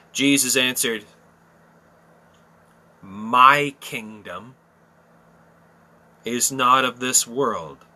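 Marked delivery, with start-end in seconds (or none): 3.0-7.8 solemn slow reading. Acted. Jesus' answer read slowly.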